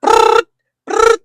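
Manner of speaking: high, loud trilled brrrt like a phone ringing; phone ring imitation. Retelling the call, imitating the phone ringing shrilly.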